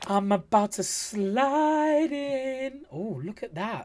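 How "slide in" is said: slow, high, stretched-out words; drawn-out teasing. Real, not acted. Reactor about to slide in, drawn-out playful delivery. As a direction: stretch the last words right out. Drawn-out joke while watching.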